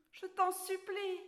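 put-on high, begging little girl; pleading. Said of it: "supplia Alice".

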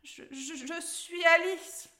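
high, hesitant, slow stammer. A narrator's reading. Little girl answers the roaring troll, stammering. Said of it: "bégaya la petite fille".

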